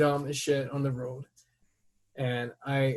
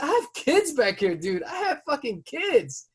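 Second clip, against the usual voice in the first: high, heated protest; yelling at driver; 11 semitones higher, 5 dB louder than usual. Retold or imitated. Father confronting the driver about his kids, high and heated. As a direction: shout it out, pitch way up. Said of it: "I said".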